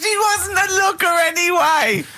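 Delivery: very high, slow squeal; hysterical laughing repeat. Real, not acted. Co-host repeating the storyteller's excuse, squealing with laughter. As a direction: shriek it back, helpless with laughter.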